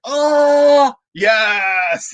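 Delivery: high, loud, drawn-out cheer; reenacted whoop of joy, easing down on the second word; elated relief. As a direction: celebrate the other team's blunder. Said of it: "the reverse of it was yesterday".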